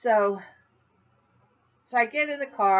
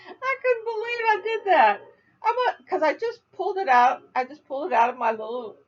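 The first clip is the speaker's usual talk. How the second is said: high, breaking with laughter; laughing; 8 semitones higher, 3 dB louder than usual. Real, not acted. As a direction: talk through giggles. Laughing at her mistake.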